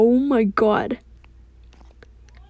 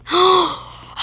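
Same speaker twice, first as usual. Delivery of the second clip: long high gasp; amazed gasp; 8 semitones higher, 4 dB louder than usual. Real, not acted. Gamer looking at the new map, amazed gasp.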